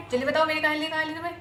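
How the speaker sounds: fast, pressing accusation; rushed demand. Acted. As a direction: fire the demand quickly. Accusing the maid.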